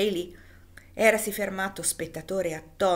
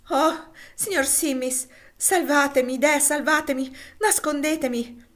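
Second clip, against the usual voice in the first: desperate plea; 6 semitones higher, 4 dB louder than usual. Acted. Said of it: "disse Elisa".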